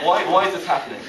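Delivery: high, loud bewildered wail; mock anguish. Runner turned monster questioning fate, mock-distraught. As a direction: cry out in comic dismay.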